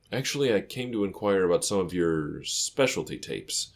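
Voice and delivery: ordinary delivery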